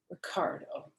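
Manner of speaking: soft, barely voiced sad sigh of a name. Girl telling her teacher about her boyfriend, sad. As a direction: sound heartbroken. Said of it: "I said real sad".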